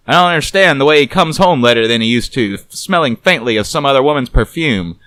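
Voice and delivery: loud, fast wisecracking private eye; gruff detective voice. A narrator's reading. Narrator voices Garbo teasing the nervous woman, wry.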